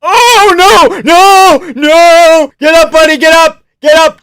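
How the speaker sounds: high, loud desperate shouts at the screen; begging the fallen fighter to get up; panicked yelling. Real, not acted. His fighter knocked down live.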